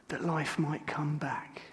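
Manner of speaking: hushed, slow whisper. Real, not acted. Grieving son at father's deathbed, a real whisper. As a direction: breathe it out barely voiced. Holding dying father's cold hand.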